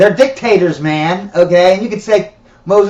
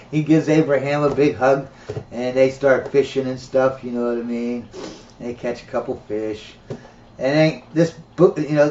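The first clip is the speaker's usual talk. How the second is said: ordinary delivery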